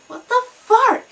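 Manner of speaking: loud, high what-the-hell shout; shocked outburst. Retold or imitated. Shocked at the stranger on the bus, a loud high outburst. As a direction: blurt it out in disbelief. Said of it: "I was like".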